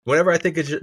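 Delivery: normal talking voice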